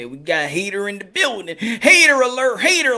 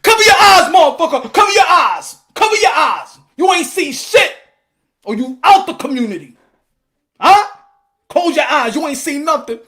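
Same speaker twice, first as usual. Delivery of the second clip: loud, high, taunting yell; brag-shouting over a knockdown; hyped shouting fan; 7 semitones higher, 9 dB louder than usual. Retold or imitated. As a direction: gloat at the crowd at full volume. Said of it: "be like".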